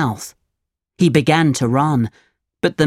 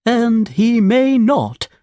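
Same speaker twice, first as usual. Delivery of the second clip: high, slow hooting owl voice; 8 semitones higher, slower than usual. Acted. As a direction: draw it out like a solemn owl. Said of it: "said the owl".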